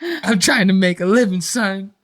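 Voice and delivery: dad telling his son he was working; mock Joel voice. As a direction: press the point to the son. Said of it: "Joel's like".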